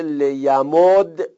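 لیمد drawn out slowly, closing on a vowelless d; silent final d. Shown as a demonstration. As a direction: stretch لیمد and stop on the d. (لیمد کشیده با دال ساکن)

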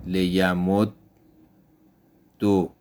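لیمدد said as "liyamuddu", doubled d ending in u; لیمدد as lesson demo, "li-ya-mud-du"; Arabic doubled dal with damma. Shown as a demonstration. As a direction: read لیمدد with a geminated dd and final u. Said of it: "ادغام با حرکت ضمه".

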